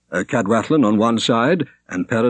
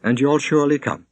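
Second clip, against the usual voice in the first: a hint of a troubled young woman, higher; lightly voiced young woman; 6 semitones higher than usual. A narrator's reading. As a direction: Shonerd pleading with a touch of sadness. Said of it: "said Shonerd".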